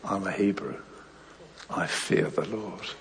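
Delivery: low, soft admission; owning up. Acted. Jonah owning up to his questioners, quiet confession. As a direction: confess it quietly, slowing at the end. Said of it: "And then he owns up".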